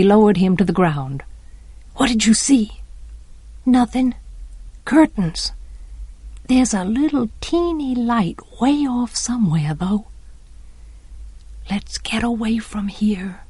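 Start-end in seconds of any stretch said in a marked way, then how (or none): 2.0-10.1 secretive, lowered volume; hushed exchange. A narrator's reading. Children asking what Dill saw, hushed. 11.7-13.4 soft, urgent whisper; breathed. A narrator's reading. Jem wanting to get away, breathed. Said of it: "breathed Jem".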